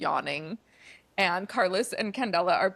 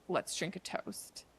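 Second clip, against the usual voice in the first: whispered; 10 dB quieter than usual. Retold or imitated. Menacing toast.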